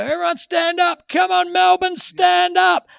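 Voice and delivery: shouted hype-man call to the crowd; rev up guy. Retold or imitated. Mocking the arena hype man to his mate, loud MC shout. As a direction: yell it like an arena MC. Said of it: "The game starts and they go".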